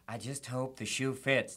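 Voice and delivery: low, slow put-on [name]. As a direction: purr it softly and slowly. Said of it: "When asked why, he said".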